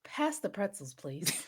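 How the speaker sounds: very soft, casual mock request; quietly unimpressed, a laugh at the end; unbothered. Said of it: "no one's taking him seriously".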